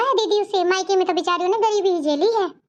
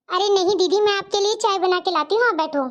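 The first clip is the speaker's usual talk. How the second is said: normal talking voice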